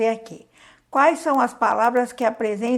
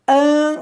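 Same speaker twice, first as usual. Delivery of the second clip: loud high syllable an; syllable stressed; 5 semitones higher, 8 dB louder than usual. Shown as a demonstration. Teacher splits ambulância for the class, loud. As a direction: punch out an as its own syllable. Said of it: "ó, uma sílaba".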